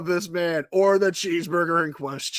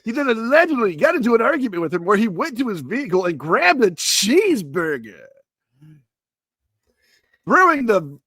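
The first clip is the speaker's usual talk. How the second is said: overhyped club DJ patter. Acted. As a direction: swoop up and down like a hyped-up DJ. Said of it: "as I slip into my D J voice".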